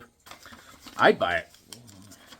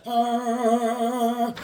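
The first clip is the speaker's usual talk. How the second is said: high, long sustained "ahh"; drawn-out high mock cry; imitated "ah"; 8 semitones higher than usual. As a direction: hold a high cartoon "ahh". Voices sound of drawn figure.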